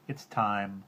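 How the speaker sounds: usual speaking voice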